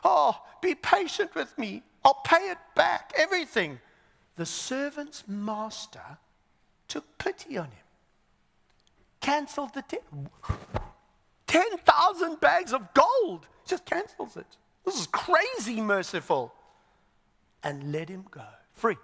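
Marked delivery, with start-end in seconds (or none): 0.0-3.8 high, loud begging for time; acted high-pitched desperate plea; desperate pleading. Servant begging on his knees. 11.5-16.5 high, loud amazement, stretched out; astonished. Acted. Preacher amazed at cancelled huge debt.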